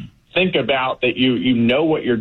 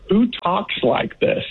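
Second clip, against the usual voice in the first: incredulous; 9 semitones higher than usual. Real, not acted. Guest echoes host's disbelief at quote.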